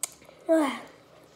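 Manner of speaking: loud sigh from the burning heat; pained heavy sigh. Real, not acted. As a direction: blow out a hard pained sigh. Mouth burning from spicy noodles.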